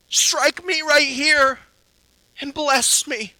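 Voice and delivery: high, loud and slow; loud, earnest offer. Retold or imitated. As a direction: say it out, offering the other cheek. Righteous man offers other cheek.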